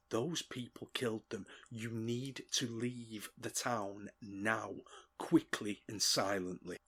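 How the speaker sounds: slow, hushed, grave warning; whispering. Acted. Dweezil whispers to the goblins to flee, slow and hushed. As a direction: murmur it slowly and softly, word by word. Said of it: "he whispers to them".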